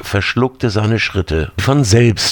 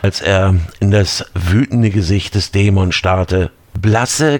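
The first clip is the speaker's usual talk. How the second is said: ordinary delivery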